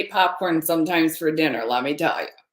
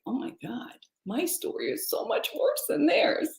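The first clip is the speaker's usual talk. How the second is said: indignant inner thought; 11 semitones higher, 5 dB quieter than usual. Retold or imitated. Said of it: "I'm thinking in my head".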